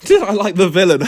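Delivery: high, laughing echo of the question; asked about the villain, bursts out laughing. Real, not acted. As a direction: laugh it off as you repeat it.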